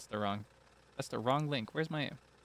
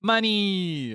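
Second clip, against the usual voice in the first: higher gleeful shout of money; playful happy exclamation; mock cheer; 5 semitones higher than usual. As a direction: cheer at the coins. Cheering game reward.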